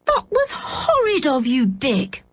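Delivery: high, shocked reproach; indignant. Acted. Anne scolding Dick for hurting the dog, indignant. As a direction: scold him in disbelief. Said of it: "Anne was indignant".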